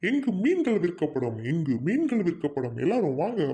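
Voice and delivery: swooping sing-song market cry; vendor's call. Acted. Fish seller inviting everyone to buy fish, calling out. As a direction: call out to passing customers.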